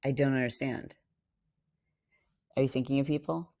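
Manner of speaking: ordinary talk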